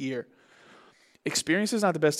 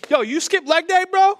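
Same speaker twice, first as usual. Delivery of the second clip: high, loud mock disbelief; incredulous ribbing; 13 semitones higher, 7 dB louder than usual. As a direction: call out the gym bro in exaggerated disbelief. Mock gym taunt at lopsided guy.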